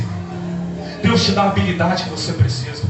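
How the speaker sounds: normal talking voice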